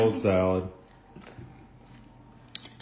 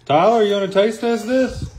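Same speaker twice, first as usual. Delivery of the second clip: calling out; 10 semitones higher, 5 dB louder than usual. Real, not acted. Calling son from the kitchen.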